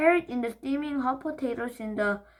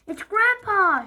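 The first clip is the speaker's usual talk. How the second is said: high, loud, slow alarmed shout; 10 semitones higher, 7 dB louder than usual. Acted. Peter shouting that Grandpa is on fire. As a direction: call out the alarm. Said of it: "shouted Perfect Peter".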